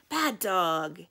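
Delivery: loud, firm telling-off; scolding yell. A narrator's reading. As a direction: scold the dog at full voice. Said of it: "I yell at him".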